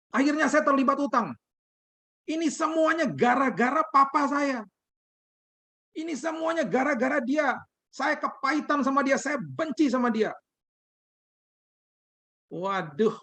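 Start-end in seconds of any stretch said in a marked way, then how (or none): 0.1-10.3 imitating a very high, bitter wail; acted anguished outburst, pitched way up; tearful accusation. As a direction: blame someone in a high, crying voice. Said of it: "dengan tangisan dia mulai berteriak".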